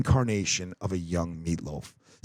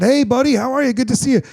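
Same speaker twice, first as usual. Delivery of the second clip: cheerful greeting; 11 semitones higher, 4 dB louder than usual. Retold or imitated. Retold cheerful greeting to nephew.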